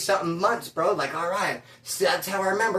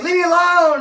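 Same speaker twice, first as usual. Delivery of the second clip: much higher and louder yell; mock shout; 10 semitones higher, 8 dB louder than usual. Annoyed son yelling at the knocking manager to leave. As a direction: yell it at the door.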